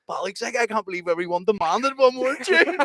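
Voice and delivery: put-on high, excited teenage disbelief. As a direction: sound sixteen and starstruck. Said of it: "we were all going".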